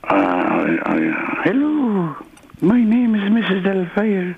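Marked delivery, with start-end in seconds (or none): 1.4-4.4 high falsetto nanny voice; amateur falsetto film impression; Mrs Doubtfire. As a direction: introduce yourself in a very high, put-on lady's voice. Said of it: "You do Missus Doubtfire then".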